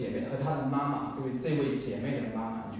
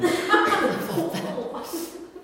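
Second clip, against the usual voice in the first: nervous laugh; 13 semitones higher than usual. Real, not acted. Embarrassed at her inner cry.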